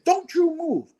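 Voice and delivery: high, sharp mock command; retold screaming order. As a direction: imitate a frantic medic ordering a patient. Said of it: "she's screaming".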